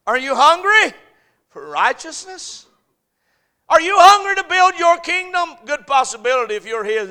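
loud, high shouted challenge to the congregation; full-force preaching. Real, not acted. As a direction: fire the questions out at the pews.

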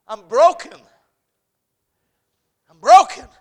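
loud, high, slow confessing cry; full-force revival sermon wail; preaching at full force. Acted. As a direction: cry out your brokenness to God.

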